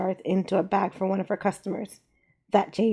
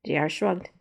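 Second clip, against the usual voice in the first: plain reading voice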